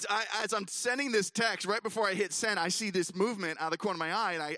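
ordinary talk, nothing marked

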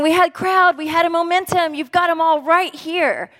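a disciple exasperated that Jesus wasted the crowd; exasperated disbelief. Retold or imitated. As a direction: pitch it up in frustrated disbelief. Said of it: "He's thinking".

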